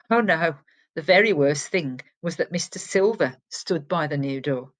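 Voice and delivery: ordinary delivery, nothing marked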